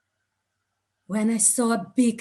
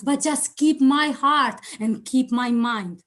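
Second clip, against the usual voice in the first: normal talking voice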